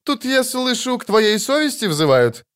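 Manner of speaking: high sneering question; mocking. A narrator's reading. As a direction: taunt the lady with a grin. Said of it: "насмешливо усмехаясь".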